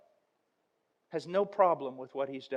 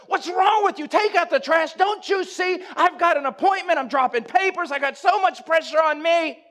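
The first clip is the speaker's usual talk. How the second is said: yelled much higher and louder throughout; a harried husband screaming back at his wife about the trash; furious shout; 13 semitones higher, 13 dB louder than usual. Retold or imitated. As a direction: explode at her, totally justified.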